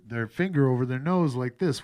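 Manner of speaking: pinched, nasal demo voice. Shown as a demonstration. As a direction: talk with a finger pressing the nose. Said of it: "which makes them sound even more nasally".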